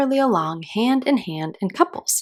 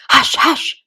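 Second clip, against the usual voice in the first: usual speaking voice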